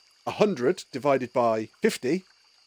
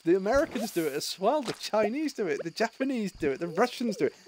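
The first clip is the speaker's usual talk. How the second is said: higher, insistent denial; animated objection; 7 semitones higher than usual. Real, not acted. Friend insisting every country does maths this way, animated higher protest. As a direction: push back hard, voice up high. Arguing it's not British.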